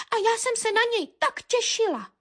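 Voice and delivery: high, small bird voice; peeping little bird. A narrator's reading. Little tit reports to the dog, high peep. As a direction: peep it out, pitched right up. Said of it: "pípla Modřinka".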